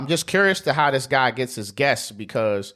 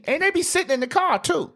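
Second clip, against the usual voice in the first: exasperated. Real, not acted. Ranting about parking.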